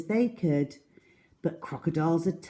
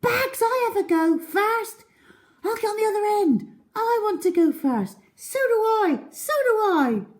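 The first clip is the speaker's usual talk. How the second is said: put-on high, eager kids all clamouring; children clamouring to ride the seesaw first; excited children shouting; 3 dB louder than usual. A narrator's reading. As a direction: shout over each other to go first. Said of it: "shouting with excitement".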